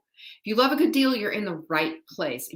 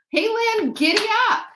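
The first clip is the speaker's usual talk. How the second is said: high, louder cowboy call; playful giddy up; 13 semitones higher, 5 dB louder than usual. Real, not acted. Host greets a viewer by name, high playful cowboy call. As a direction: spur a viewer on like a horse.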